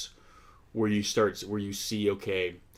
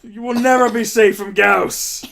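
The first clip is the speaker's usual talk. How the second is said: villain shout; 14 semitones higher, 10 dB louder than usual. Pretend. Mocking villain shouting at humans.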